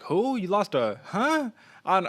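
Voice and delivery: high incredulous mock casual fan; a hammed-up "who?" from a confused casual fan; mock disbelief. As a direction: squeak it out like a baffled casual fan. Mocking casual fans' reaction.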